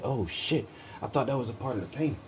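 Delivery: hushed aside. Retold or imitated. Recalling being fooled.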